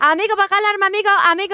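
agudo y fuerte, suplicando que baje el arma; súplica a voces. Real, not acted. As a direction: ruega con prisa y en alto, nerviosa. Begs armed player to lower gun. (al jugador armado, súplica alta y nerviosa)

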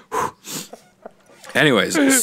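normal talking voice

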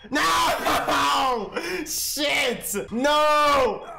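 high, stretched shouts breaking into laughter; shouted, laughing dismay; laughing despair. Real, not acted. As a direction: wail in protest while cracking up. Karma strikes, laughing despair.

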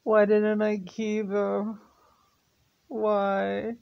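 slow, loud, pained question; anguished. Real, not acted. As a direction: ache over the loss. Grieving over giving dog back.

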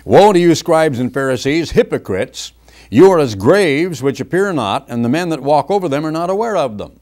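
forceful scripture reading, opening loud; preaching scripture. Acted. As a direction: thunder out the woe, then drive it on. Reads Christ's rebuke with force.

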